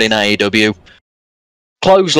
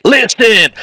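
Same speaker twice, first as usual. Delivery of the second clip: high, loud rapper shout; a loud hype-man yell; mock rapper call; 9 semitones higher, 3 dB louder than usual. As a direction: call the crowd to listen. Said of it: "He's about to rap".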